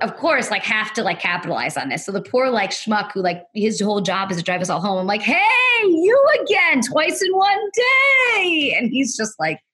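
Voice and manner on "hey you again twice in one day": high, loud, drawn-out hail; mock-cheery greeting. Passenger capitalizing on meeting the same driver twice, mock-cheery shout. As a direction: sing it out high, stretching the last word.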